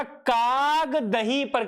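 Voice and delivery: very slow "काग", stretched, then "दही पर" as separate words; break after काग. Shown as a demonstration. Teacher giving the crow-and-curd reading, break after "काग". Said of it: "ठीक है, दूसरा".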